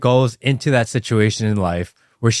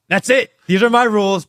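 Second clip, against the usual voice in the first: higher, louder mock of a strict dad; mock stern father; 9 semitones higher, 3 dB louder than usual. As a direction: bark the rules like a strict dad. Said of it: "Because you say".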